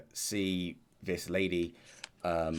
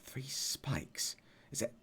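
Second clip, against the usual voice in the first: whisper; 6 semitones higher, 6 dB quieter, slower than usual. Real, not acted.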